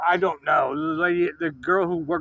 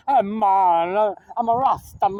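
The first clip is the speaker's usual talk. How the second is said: put-on Jamaican accent, vowels stretched; hammed-up Jamaican Rasta voice; mock Jamaican Rasta; 3 dB louder than usual. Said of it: "'cause that's how they do it now".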